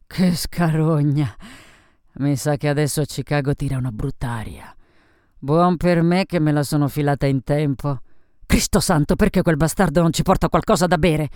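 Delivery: loud, coarse tipsy grumbling; boozy woman's noisy griping; drunken, brash Sophie, complaining. Acted. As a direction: complain loudly, impatient for a drink.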